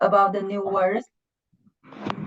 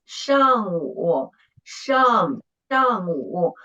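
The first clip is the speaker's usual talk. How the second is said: 上午 stretched out slowly, syllable by syllable; slow teacher's demonstration of 上午; slow model; much slower than usual. Shown as a demonstration. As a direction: draw out 上 and 午 for a learner to copy. Teacher models the word after student.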